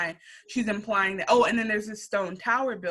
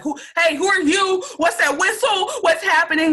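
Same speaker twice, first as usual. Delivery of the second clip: high, loud frantic questions; alarmed shouting; 11 semitones higher, 6 dB louder than usual. Retold or imitated. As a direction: shout it out in a panic, pitched way up. Confused man confronts stranger.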